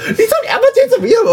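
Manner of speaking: very high, rushed, laughing gossip; mom's excited voice. Retold or imitated. Mom phoning her daughter about grandma, high fast laughing. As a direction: blurt it out fast and high like breaking juicy news. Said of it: "然后打电话跟我说".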